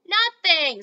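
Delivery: loud quick denial. Acted. Hushing the waking grandma.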